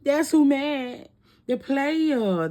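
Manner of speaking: emphatic reveal. Real, not acted. Reader revealing the angry player to viewers, loud and emphatic. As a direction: land it like a gotcha. Answers her own question, emphatic.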